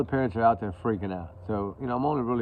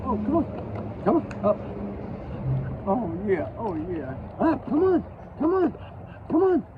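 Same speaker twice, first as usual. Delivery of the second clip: high, loud eager coaxing; coaxing dog; 12 semitones higher, 3 dB louder than usual. Real, not acted. Man coaxing his dog to jump into his lap, excited high calls.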